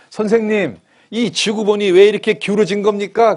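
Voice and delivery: loud, high indignant demand; indignant inspector. Retold or imitated. School inspector confronting the teacher in a joke, loud indignant demand. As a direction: demand an answer, outraged.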